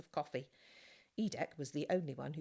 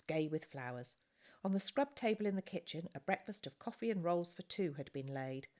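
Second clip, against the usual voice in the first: ordinary delivery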